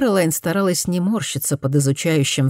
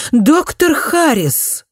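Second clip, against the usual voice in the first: high, slow, louder sharp call of his name; sharp rebuke; 11 semitones higher, 4 dB louder, much slower than usual. A narrator's reading. Lady of the house sharply rebuking the doctor, high and loud. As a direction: snap his name to pull him up short. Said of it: "резко одёрнула его".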